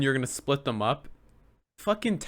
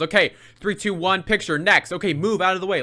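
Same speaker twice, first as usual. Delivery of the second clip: high, loud rapid-fire orders; brisk photo-line handler; 7 semitones higher, 6 dB louder than usual. Retold or imitated. Event staffer moving the picture line along, brisk and loud. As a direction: imitate a woman herding people through, raised voice. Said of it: "the woman goes".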